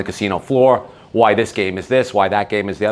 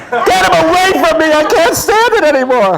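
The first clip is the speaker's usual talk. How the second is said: high, loud, fed-up shriek of protest; shrieking wife; 8 dB louder than usual. Retold or imitated. Wife begging husband to stop the videos, loud high shriek. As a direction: shriek it like a wife who can't take any more. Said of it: "And she went like this".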